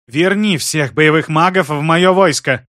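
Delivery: loud, slow demand; angry shout. Acted. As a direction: shout it out slowly. Sister demands angrily.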